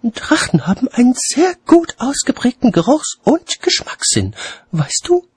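high-pitched, emphatic lady voice; lecturing lady. A narrator's reading. Lady explaining dragons to watchman, high instructive voice. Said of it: "wandte Lady Cassidy ein".

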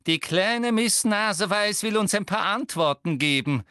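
hoch und spöttisch triumphierend; verkünde hämisch deinen Sieg. A narrator's reading. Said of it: "mit triumphierender Miene". (triumphierend, mit hoher Stimme)

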